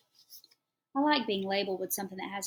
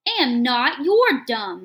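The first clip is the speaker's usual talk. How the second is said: put-on shrill kid shrieking much louder, higher; screeching child; 4 semitones higher, 13 dB louder, slower than usual. A narrator's reading. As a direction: scream it back like a furious little boy. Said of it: "Michael Eric screeches".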